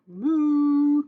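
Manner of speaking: loud drawn-out moo; cow moo. Acted. Teacher voicing the cows with a long loud moo.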